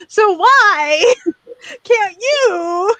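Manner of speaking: high, drawn-out comic plea; hammed-up baffled pleading; mock exasperation. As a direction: wail it in playful disbelief, half laughing. Mock exasperation at Australians' accent attempts.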